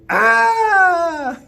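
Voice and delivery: high startled scream; scared cry. Acted. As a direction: yelp in fright. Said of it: "Daddy was scared".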